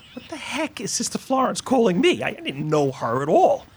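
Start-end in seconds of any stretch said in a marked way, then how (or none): 0.1-2.2 higher, puzzled inner voice; retelling his own confusion at the call; baffled. Retold or imitated. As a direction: wonder aloud why she's calling. Retold own baffled reaction.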